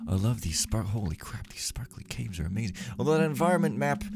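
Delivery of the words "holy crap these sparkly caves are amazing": awed whisper; hushed, amazed whisper. Real, not acted. Awed while sneaking.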